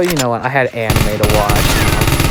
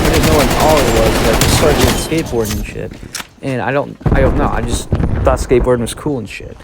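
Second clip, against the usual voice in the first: ordinary talk, nothing marked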